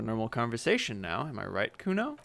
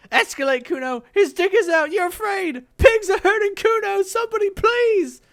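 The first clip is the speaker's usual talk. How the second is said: very high, loud screaming; kid screaming for help; 12 dB louder than usual. Acted. Kuno screams that pigs hurt him, high and loud. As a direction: yell it to the windows.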